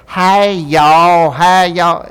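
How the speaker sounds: loud, high, hammed-up Hollywood Southern drawl; Hollywood Southern accent. Casting director demonstrating a "real" accent, fake Hollywood Southern. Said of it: "That's a Hollywood Southern accent".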